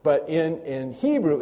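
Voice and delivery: ordinary talk, nothing marked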